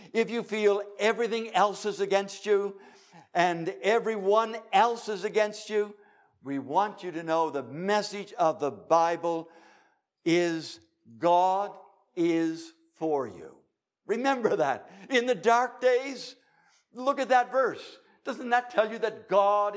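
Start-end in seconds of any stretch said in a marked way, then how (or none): none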